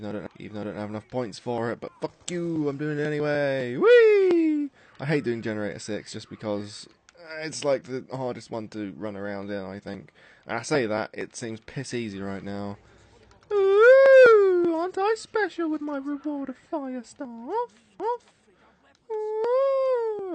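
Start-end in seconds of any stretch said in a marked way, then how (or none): 3.8-4.7 very high, loud squeal of glee; delighted squeal while racing in the game; gleeful whee. Real, not acted. 13.5-20.3 very high, loud mock-posh squeal; camp, self-satisfied high voice over a new reward; smug high voice.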